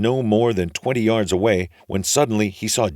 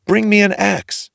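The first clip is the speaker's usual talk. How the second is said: loud, much higher desperate shout; calling out; 13 semitones higher, 8 dB louder than usual. A narrator's reading. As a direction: yell for help from the beanstalk. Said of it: "he called out".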